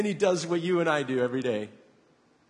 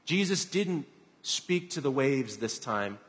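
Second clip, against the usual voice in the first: ordinary delivery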